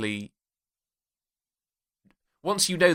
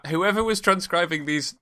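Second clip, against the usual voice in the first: ordinary talk, nothing marked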